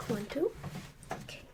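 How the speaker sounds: ordinary talk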